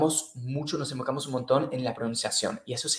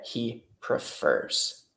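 slow model form; 3 semitones lower, 4 dB quieter, much slower than usual. Shown as a demonstration. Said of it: "Sería".

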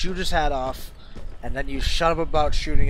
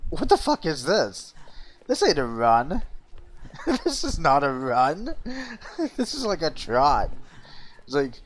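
ordinary talk

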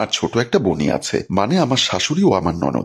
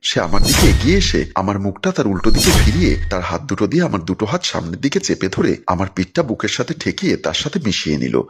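usual speaking voice

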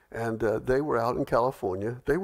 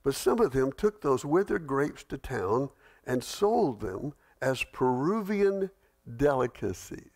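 ordinary talk, nothing marked

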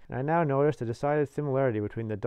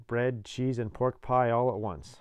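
ordinary delivery

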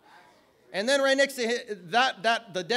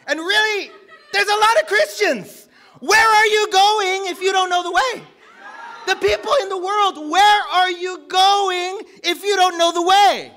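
high, loud challenge to the congregation; full-force preaching; 8 semitones higher, 8 dB louder than usual. Real, not acted. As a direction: shout the question out like a preacher. Preaching the title question at force.

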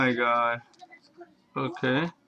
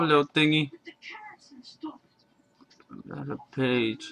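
usual speaking voice

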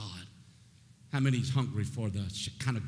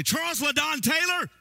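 much higher, louder shout of a full name; mom's full-name yell; 7 dB louder than usual. Retold or imitated. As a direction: yell it like a mother calling her son in trouble. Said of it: "Mom would say".

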